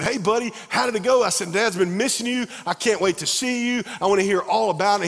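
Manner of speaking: high, bright-eyed call to his son; eager father glad to hear his boy; dad welcoming his son home after two weeks away, excited; excited dad greeting. Retold or imitated.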